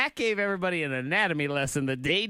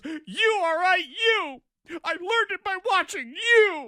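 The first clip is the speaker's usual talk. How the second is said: high, loud yelled PSA line; hammed-up anguished yell at dad; mock teen shout; 8 dB louder, slower than usual. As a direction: shout it like the busted teenager. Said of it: "And he goes".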